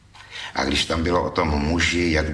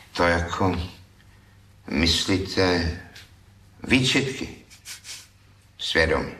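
halting, with long pauses between words; hesitant. Acted. Thief hesitantly asks the critic about conscience, halting. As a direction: grope for each word uncertainly. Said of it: "řekl váhavě".

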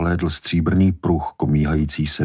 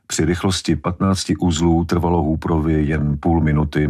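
usual speaking voice

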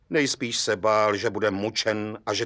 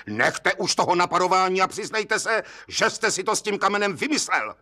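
high-pitched, impatient insistence; exasperated urging; 8 semitones higher than usual. A narrator's reading. Judge urging the suspect to recant, exasperated. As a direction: push the suspect to give in, voice raised high.

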